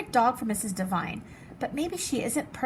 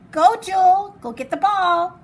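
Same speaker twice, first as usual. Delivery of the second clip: higher, much louder call to the dog; yell; 8 semitones higher, 10 dB louder than usual. Acted. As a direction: shout across the yard at the dog. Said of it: "I yell".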